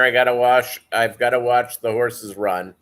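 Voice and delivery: ordinary delivery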